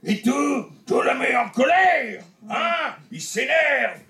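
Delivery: slow, rising into a shout of anger; Jean-Marie Bigard. Retold or imitated. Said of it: "je faisais Jean-Marie Bigard".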